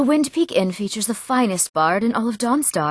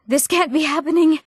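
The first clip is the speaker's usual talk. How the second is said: high-pitched, dismayed outburst; distressed disbelief; 7 semitones higher than usual. Acted. Calling on the gods as disaster strikes, distressed. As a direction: let the pitch rise in horrified disbelief.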